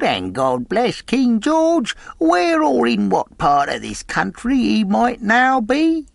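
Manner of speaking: high, slow whining sing-song of a blind beggar; odd sing-song beggar. A narrator's reading. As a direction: wheedle it out like a pitiful blind beggar. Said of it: "raising his voice in an odd sing-song".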